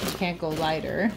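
normal talking voice

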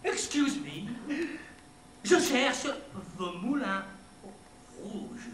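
halting French with an English accent; hammed-up English accent, broken into short phrases. Shown as a demonstration. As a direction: play an English tourist speaking French. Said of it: "c'est l'accent anglais".

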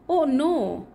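plain reading voice

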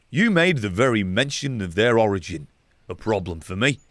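ordinary talk, nothing marked

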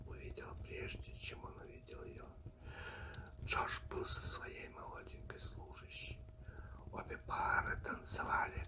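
ordinary delivery

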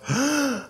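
high, drawn-in gasp; sharp theatrical intake of breath; mock gasp. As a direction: gasp in put-on shock. Mock shock after naming his show.

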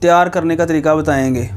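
channel intro voice. Acted. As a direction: pitch it like a host opening the show to viewers. Energetic YouTube intro voice. (जोशीले अंदाज़ में video की शुरुआत)

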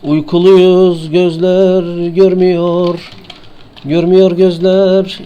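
slow, high, drawn-out sleepy sing-song; mock sleepy drone. Sleepy gamer playing eyes closed, hammed-up slow drone. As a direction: drag it out like a sleepwalker. Drowsy chant while playing.

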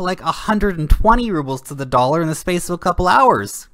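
loud, heated, pitch pushed up; angry rant. Real, not acted. Vlogger ranting at Putin about the ruble crash, loud and angry. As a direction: jab at him like he's lying to you.

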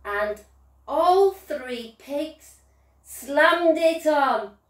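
usual speaking voice

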